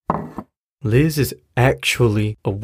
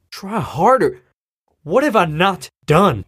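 pitched much higher, disbelieving; stung, indignant disbelief; incredulous; 8 semitones higher than usual. Acted. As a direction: throw the words back at her in disbelief. Father angry in argument.

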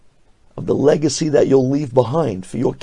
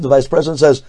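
normal talking voice

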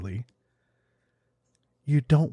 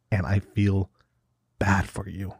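whispered pity; 3 semitones lower than usual. Real, not acted. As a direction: whisper "bad for you" in mock sympathy.

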